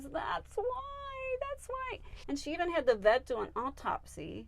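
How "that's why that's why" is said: very high mock wail; imitated sobbing. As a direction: imitate a tearful owner, high and thin. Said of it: "sobbing and sobbing. And she goes".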